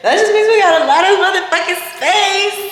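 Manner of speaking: high, loud excited squeal; thrilled about the space. Real, not acted.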